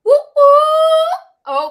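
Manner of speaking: high, loud party whoop; hype whoop. Real, not acted. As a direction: shout it out to hype the room. Hype call to the chat.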